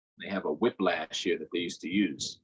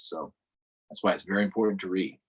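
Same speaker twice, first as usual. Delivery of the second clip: usual speaking voice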